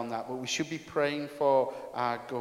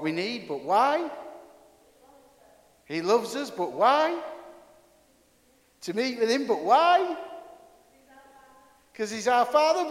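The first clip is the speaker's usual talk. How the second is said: rising higher and higher; 7 dB louder than usual. Real, not acted. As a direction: pitch climbs with every "why". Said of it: "I don't know if I could go any higher".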